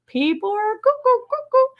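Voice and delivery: high sing-song tease; singsong cuckoo. Acted. Singsong teasing about commenters.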